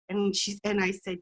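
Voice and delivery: ordinary talk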